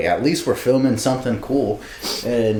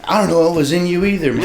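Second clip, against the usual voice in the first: higher, exasperated play-acted telling-off; mock scolding; 7 semitones higher than usual. Acting out HR berating himself, mock exasperation. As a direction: scold in high, hammed-up disbelief.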